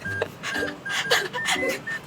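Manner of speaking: airy high-pitched giggle; high breathless giggle. Real, not acted. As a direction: let a breathy giggle slip out. Laughing at her boss's indifference. (mataas at pabulong na hagikgik)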